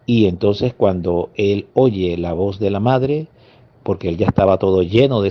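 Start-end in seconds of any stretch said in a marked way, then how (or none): none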